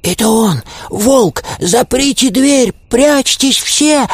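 high-pitched alarmed hare; shrieky alarm without much extra volume; panicked cry. A narrator's reading. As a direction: cry out urgent warnings, voice shooting way up. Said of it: "закричал заяц".

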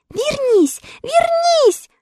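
put-on girl begging the woodman to come back, pitched high; pleading cry. A narrator's reading. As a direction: call him back in a panic. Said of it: "закричала Элли и с плачем".

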